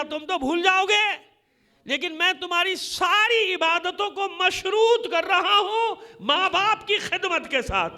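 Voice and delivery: ordinary talk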